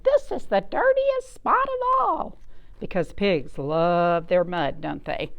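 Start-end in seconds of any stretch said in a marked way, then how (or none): 0.0-2.4 much higher, shocked housewife voice; a high, horrified farm wife; dismayed farmer's wife. Acted. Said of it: "cried the farmer's wife".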